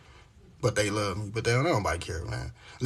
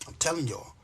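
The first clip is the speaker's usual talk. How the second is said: ordinary delivery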